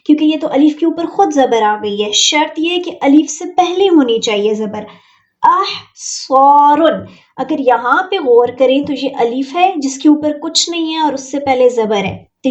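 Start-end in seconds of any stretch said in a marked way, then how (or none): 5.4-7.1 अहसॉरुन with सॉ stretched, louder; slow recitation. Shown as a demonstration. Teacher models madda for students, slow and loud. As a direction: recite अहसॉरुन slowly, holding सॉ. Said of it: "एक अलीफ जितना लंबा कर रही हूँ".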